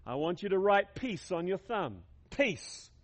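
ordinary talk, nothing marked

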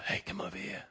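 high, very soft beckoning; whisper. Acted. Tempter beckoning you to the fence, whispered. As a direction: coax someone over in a hushed voice. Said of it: "and then you hear a whisper".

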